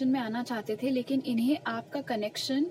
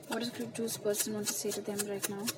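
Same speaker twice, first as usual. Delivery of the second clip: slow, soft, hushed asking of the cards; ritual question to spirit; 5 dB quieter, much slower than usual. Acted. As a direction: ask the spirit slowly and softly while shuffling.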